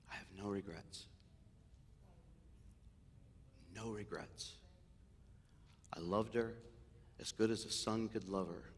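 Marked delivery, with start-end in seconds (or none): 0.1-4.5 hushed. Retold or imitated. Quoting himself at mother's death.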